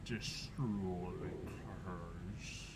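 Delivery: low, soft, eerie monster voice; slow unearthly whisper; distorted voice. Acted. GM voices the cursed chieftain.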